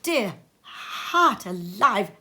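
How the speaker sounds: plain reading voice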